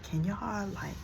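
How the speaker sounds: barely voiced polite request; hushed request. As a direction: ask them to pipe down without making a scene. Asking loud brothers to quiet down.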